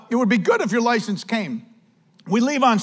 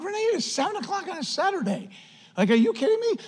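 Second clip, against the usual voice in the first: exasperated; 11 semitones higher, 6 dB quieter, faster than usual. Retold or imitated. Exasperated at wife's idea.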